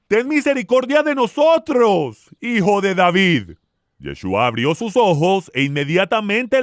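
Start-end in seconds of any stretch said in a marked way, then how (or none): 0.1-3.6 high-pitched acted plea; crying out. A narrator's reading. Two blind men follow Yeshua, crying out high. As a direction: narrator voicing a pleading call. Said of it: "dando voces y diciendo".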